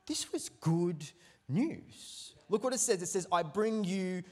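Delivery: slow, deliberate reading of the verse; scripture reading. Real, not acted. Preacher reads the angel's words to shepherds, slow and deliberate. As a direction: let each word of the promise land.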